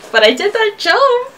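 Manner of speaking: high, loud indignant protest; indignant shout. Real, not acted. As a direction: protest it, outraged. Protesting failed jump.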